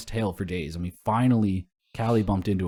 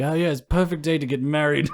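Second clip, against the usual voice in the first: hammed-up pirate shout, pitched high and louder; imitating a boisterous pirate crashing in; Jack Sparrow; 7 semitones higher, 5 dB louder than usual. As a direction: holler it like a drunken pirate impersonator. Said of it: "just starts shouting shit".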